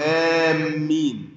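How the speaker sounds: آمين drawn out with a heavy doubled m. Shown as a demonstration. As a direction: lean long on the m of آمين, aam-meen. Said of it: "وهذا خطأ". (آمين بتشديد الميم)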